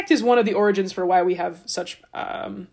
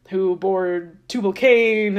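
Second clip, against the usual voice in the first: ordinary talk, nothing marked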